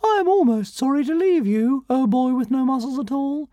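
high little dragon voice, a touch sorrowful; tiny dragon, mildly regretful farewell. A narrator's reading. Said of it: "said Zigarastica sorrowfully".

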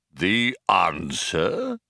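slow, drawn-out machine question; slow computer voice. A narrator's reading. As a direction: stretch it like a pondering machine.

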